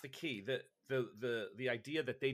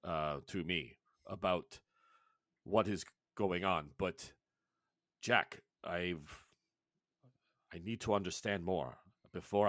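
lower, slow, halting broken English; measured village elder in careful broken English; a grave elder weighing his words in broken English; 5 semitones lower, 4 dB louder than usual. Acted. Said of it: "in his best broken English".

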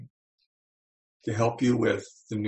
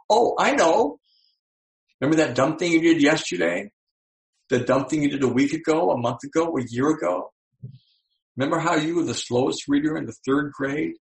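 much higher, fast, eager taunting; taunting brain voice; 4 semitones higher than usual. Acted. The brain eagerly reminds the person of their failures, high and taunting. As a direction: gleefully dig up every old failure.

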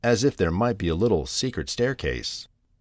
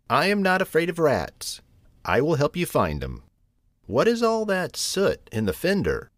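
higher, lightly voiced brisk helper; resolute cousin cat; 8 semitones higher than usual. Rigby offers to help find Thomas, lightly resolute. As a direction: take charge with a touch of bravado.

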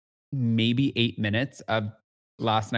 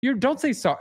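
mock indignant; 11 semitones higher than usual. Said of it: "Monica's like".

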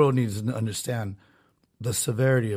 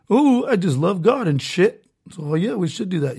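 mock churchgoer; 6 semitones higher, 4 dB louder than usual. Mocking naive churchgoers.

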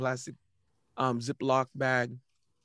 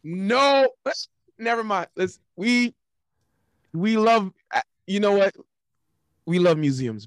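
high, loud hasty retraction; loud backpedal; 7 semitones higher, 6 dB louder than usual. Real, not acted. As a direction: blurt out a quick retraction. Backtracks loudly, embarrassed.

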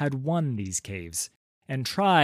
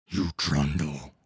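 much lower gravelly troll; rumbling gravelly voice; 9 semitones lower than usual. A narrator's reading. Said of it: "with a rumbling, gravelly voice".

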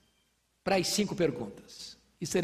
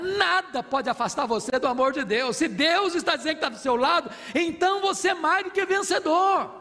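higher, louder triumphant build; fervent proclamation building higher and louder; rising preaching climax; 7 semitones higher, 3 dB louder than usual. Acted. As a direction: lift it into victory.

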